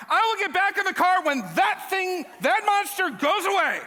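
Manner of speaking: high, loud panicked shout; terrified yell. Retold or imitated. Man on the ride yelling at the attendant, terrified. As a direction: yell it in full-blown terror. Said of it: "And I yell".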